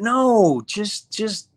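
high, urgent retold "no"; alarmed protest. Retold alarmed plea to stop.

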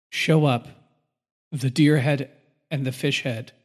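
ordinary delivery

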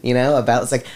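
usual speaking voice

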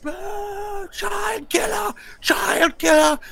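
very high shouted boos and jeers; jeering crowd. Retold or imitated. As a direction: yell abuse like an angry mob. Hostile crowd jeers the player.

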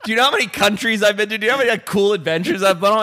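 high-pitched mock outrage, rushing as it builds; retold exasperated rant; indignant retort. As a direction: fire back, offended and incredulous. Retold indignant retort to nagging guide.

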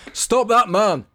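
the choked steward calls for help, shouting; breathless shout. A narrator's reading. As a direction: yell after the runaway. Steward shouts after fleeing Tony.

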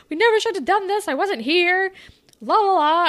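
high mock-panicked Miss O. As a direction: playfully voice a teacher pretending she wasn't there. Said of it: "Miss O was like".

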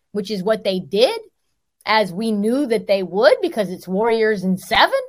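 ordinary talk, nothing marked

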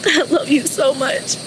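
high, broken, sobbing through words; crying out love and sorry; tearful goodbye. Real, not acted. Daughter's last goodbye to father.

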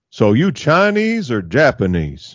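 slow drawn-out question with a big swoop on Chinese; dim, slow cartoon neighbour; Hank Hill. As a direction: stretch it out, pitch looping up and down. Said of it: "Hank just looks at him and goes".

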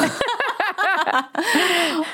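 high-pitched real laughing fit; long, high burst of laughter. Real, not acted. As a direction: crack up at your own remark. Laughs at her own joke.

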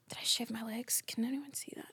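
hushed, self-conscious whisper; whispered aside. Real, not acted. Speaker interrupts herself to check her legs, whispered aside. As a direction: drop to a whisper, checking privately.

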